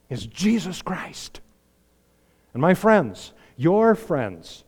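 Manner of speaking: ordinary delivery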